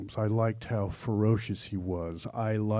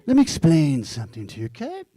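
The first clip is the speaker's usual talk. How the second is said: fast, high Mid-Atlantic lecturing tone; father's Mid-Atlantic accent; 4 semitones higher, 4 dB louder, much faster than usual. Retold or imitated. Father explaining to young Nicholas, in a quick, high Mid-Atlantic accent. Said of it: "who always spoke with".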